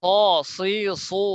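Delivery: স, সি, সু thick and rounded, with a w-like glide; heavy syllables. Shown as a demonstration. Teacher drilling repeat-after-me, heavy letters. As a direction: keep স, সি, সু full-mouthed, each drawn out. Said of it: "সিনের মতো যেন না হয়".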